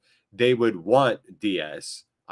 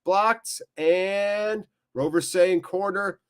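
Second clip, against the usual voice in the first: raised-pitch football commentary; live play-by-play; 6 semitones higher than usual. Real, not acted. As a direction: call the action as it happens.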